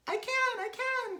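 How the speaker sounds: very high, loud squeals; Mrs. Twit's excited cry. A narrator's reading. Wife answering as balloons pull her, high cry. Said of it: "cried Missus Twit".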